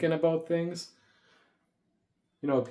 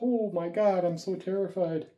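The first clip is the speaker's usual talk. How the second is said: high, hammed-up mock fright; playful imitation of the other pets being terrified; 5 semitones higher than usual. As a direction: squeal like a scared pet, jokingly. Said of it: "they're like".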